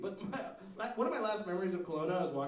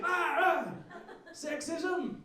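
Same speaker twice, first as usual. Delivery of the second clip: crazy street preacher; 4 semitones higher, much slower than usual. Retold or imitated. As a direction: ham up a raving street guy yelling into a pigeon. Crazy guy screaming with pigeon.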